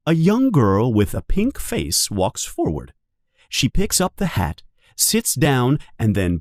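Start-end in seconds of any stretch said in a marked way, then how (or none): none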